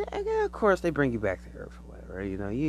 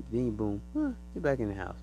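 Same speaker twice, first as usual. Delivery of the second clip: normal talking voice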